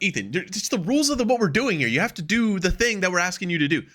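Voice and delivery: high, exasperated lecturing voice; mock scolding official. Imitating someone telling Ethan the rules, high exasperated. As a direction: lay down the rules to a stubborn wrestler.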